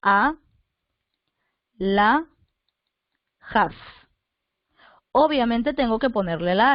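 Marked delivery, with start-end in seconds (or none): 0.0-3.7 A, la, jas spaced far apart; slow dictation of A, la, jas with long gaps; syllable by syllable. Shown as a demonstration. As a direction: say A... la... jas one syllable at a time. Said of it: "sepáralo".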